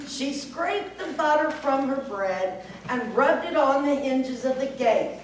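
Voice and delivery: ordinary delivery, nothing marked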